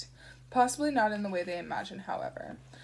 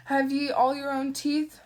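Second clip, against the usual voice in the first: put-on gruff roaming British accent, louder and higher; unplaceable British accent; 5 semitones higher, 7 dB louder than usual. Shadwell quizzes Newton, unplaceable British accent. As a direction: bark it in a wandering British accent. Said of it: "Mister Shadwell's accent was unplaceable".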